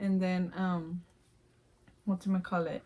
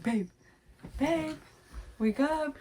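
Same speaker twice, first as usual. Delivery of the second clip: higher, pleading call to wake her; panicked husband; 6 semitones higher, slower than usual. Retold or imitated. Husband trying to wake his wife, higher and pleading. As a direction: coax her awake, anxious. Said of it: "He's like".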